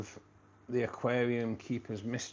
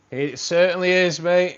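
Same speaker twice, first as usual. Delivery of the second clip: loud, higher-pitched burst of pride; proud confirmation; 7 semitones higher, 13 dB louder than usual. Real, not acted. Streamer confirming his Manchester accent to a viewer, loud and proud. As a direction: belt it out proudly, voice lifted.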